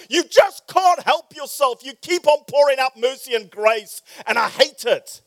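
loud, exasperated accusation at God; angry Jonah. Acted. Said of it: "Jonah is so angry".

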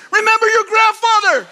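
high, loud reminder laughed through; wife calling out a reminder, laughing; wife reminds him with a laugh; wife's reminder. Retold or imitated. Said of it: "and she goes".

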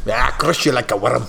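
hammed-up Scottish threat; Scottish accent. Shown as a demonstration. Host shows off his Scottish to laughing colleagues, mock Scottish accent. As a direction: put on a silly Scottish accent. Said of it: "I could do Scottish".

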